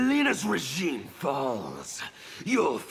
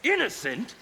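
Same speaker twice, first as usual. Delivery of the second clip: high, incredulous sneer on the word; scornful echo; 8 semitones higher than usual. Acted. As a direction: throw the word back in disbelief.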